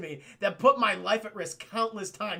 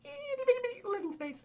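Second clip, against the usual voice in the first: very high, fast little squeak; tiny squeezed voice; faster than usual. Retold or imitated. Genie jokes about the cramped lamp, tiny squeezed voice.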